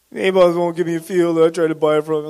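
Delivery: quick, whining complaint; whiny sob story. Retold or imitated. Sulking king complains to his wife, fast and whiny. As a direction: rattle off a self-pitying whine. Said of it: "Like this sob story".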